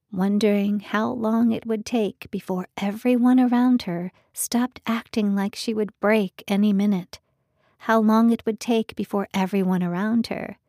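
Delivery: usual speaking voice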